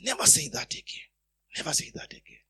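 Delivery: whispered rebuke; whispered warning, Never stressed; inner whisper. Acted. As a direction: hush it like a voice inside. Holy Spirit rebuking him inwardly.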